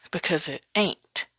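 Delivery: usual speaking voice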